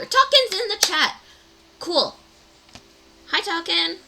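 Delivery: high, loud, happy shout; excited greeting. Real, not acted. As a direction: call out with delight. Greeting a chat viewer.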